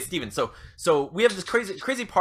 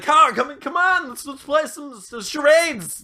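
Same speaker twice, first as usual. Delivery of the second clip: high, wheedling plea to keep going; pleading party host; 11 semitones higher, 5 dB louder than usual. Acted. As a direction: beg them to stay and play, pitched way up. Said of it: "Trying to, like, keep the party".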